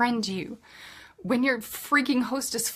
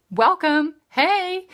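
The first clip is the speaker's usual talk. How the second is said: excited welcome; 9 semitones higher, 9 dB louder, slower than usual. Real, not acted. Greeting new joiners.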